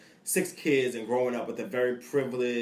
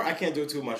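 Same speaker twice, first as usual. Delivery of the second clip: ordinary talk, nothing marked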